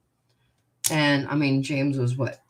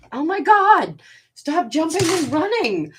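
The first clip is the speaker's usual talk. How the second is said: overwhelmed by cuteness; 10 semitones higher, 4 dB louder than usual. Real, not acted. Cat running around wildly.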